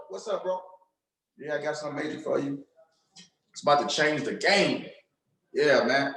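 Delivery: low, flat, bored "what's up, bro"; monotone cool. Shown as a demonstration. Coach mocking a bored, too-cool caller. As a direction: play it cool and uninterested. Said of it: "This ain't time to be cool for school".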